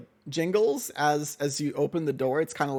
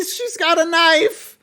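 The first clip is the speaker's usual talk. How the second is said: put-on much higher, louder, slow panicked boy yelp; panicked shout; 13 semitones higher, 11 dB louder, slower than usual. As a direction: cry out in alarm at the knife. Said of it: "Mortimer's just gonna shout".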